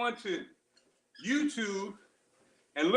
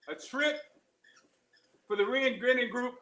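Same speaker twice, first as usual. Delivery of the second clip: ordinary talk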